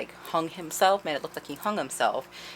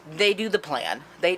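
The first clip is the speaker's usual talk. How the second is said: ordinary talk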